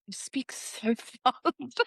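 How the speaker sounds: slow quote breaking into laughter; drawn-out words dissolving into a laugh; laughing retelling. Retold or imitated. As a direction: crack up while quoting a complaint. Retold complaint, teller cracking up.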